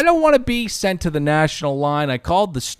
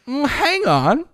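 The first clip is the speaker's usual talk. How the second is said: higher, puzzled double-take; incredulous; 10 semitones higher than usual. Retold or imitated. Caller realizing the clerk is at another store, incredulous. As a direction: catch the clerk out, baffled.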